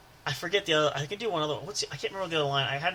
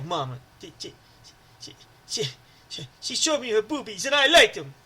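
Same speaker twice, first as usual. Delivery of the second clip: Water Boy impression; 5 dB louder than usual. Telling the salesman about mama in a stammering Water Boy voice. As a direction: stutter about mama, then blurt it out. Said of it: "I go".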